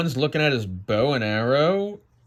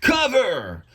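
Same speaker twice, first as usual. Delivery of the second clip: high, sharp warning shout; urgent command; 10 semitones higher, 4 dB louder than usual. Acted. Satan sees the God ball coming, urgent shout. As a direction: yell it to troops under fire.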